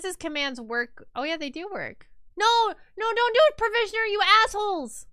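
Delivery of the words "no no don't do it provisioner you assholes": high, loud furious protest; angry yell. Real, not acted. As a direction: scream at the voters. Chat outvotes her.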